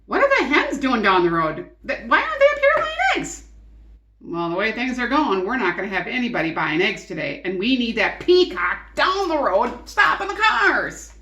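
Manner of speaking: loud, fast, exasperated; a hint of a fed-up farm wife; farmer's wife fretting about the egg business. A narrator's reading.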